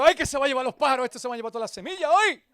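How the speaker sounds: panicking in jest; alarmed joke. Retold or imitated. Pastor jokes to the congregation that the birds will take the seed, fast mock panic. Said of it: "Y digo".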